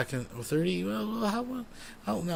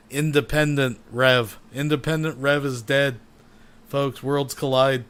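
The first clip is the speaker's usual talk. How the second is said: slowed-down, loud and booming; slow dramatic announcer; 6 dB louder, slower than usual. Pretend. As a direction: drag every word out like slow motion. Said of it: "Let's go slower".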